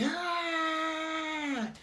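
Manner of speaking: long, high, held "ah"; mock baby cry, softened in level; imitated baby's wail. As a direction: mimic a little baby crying. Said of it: "because the little baby".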